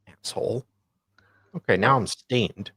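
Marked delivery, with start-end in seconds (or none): 0.1-0.6 much softer, lower mock curse under the breath; low muttered mock insult; mock grumble. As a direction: grumble it like a petty rival. Mock outrage over the stolen seat.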